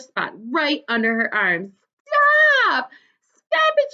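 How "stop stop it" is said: high shrieking "Stop!" through tickles. A narrator's reading. Girl tickled by her dad, shrieking loud and high. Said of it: "she shrieked".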